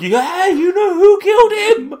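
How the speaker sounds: very high, slow, laughing; high slow mock agreement; flippant agreement. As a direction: agree far too casually.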